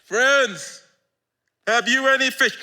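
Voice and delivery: a friendly shout from the beach; Jesus calling out. Acted. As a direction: call across the water warmly. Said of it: "he called out to them".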